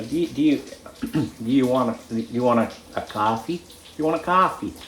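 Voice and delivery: attempted American accent, feeling out the "coffee". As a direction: put on an American "cahfee". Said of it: "in your American accent".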